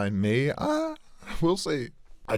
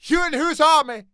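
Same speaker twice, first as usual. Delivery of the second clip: much higher, louder taunt; mocking bully; 6 dB louder than usual. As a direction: sneer at a nervous boy.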